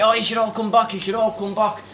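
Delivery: high, eager invite, imitating the hairdresser; mock hostess calling everyone back; hairdresser inviting.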